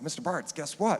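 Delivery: high, bouncy imitation of an excited teen; eager kid. Student phoning his band teacher at night, imitating excitement. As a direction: play the kid bursting with news.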